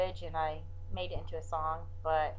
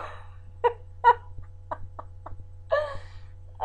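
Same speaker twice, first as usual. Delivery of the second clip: airy laughing burst; breathy laugh; 4 dB louder than usual. Real, not acted. Laugh after song.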